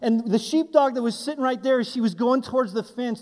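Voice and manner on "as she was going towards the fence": usual speaking voice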